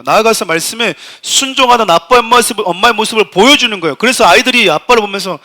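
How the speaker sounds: 크게 힘주어 설교하듯; 목소리를 높여 회중에게 강하게 역설. Real, not acted. (힘 있게 외치는 설교조)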